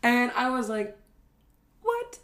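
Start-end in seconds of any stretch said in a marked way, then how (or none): 1.8-2.2 high, stunned yelp; shocked. Retold or imitated. Him reacting to hearing about the concentrate, high and shocked. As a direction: squeak it out in disbelief. Retelling his shock at the news.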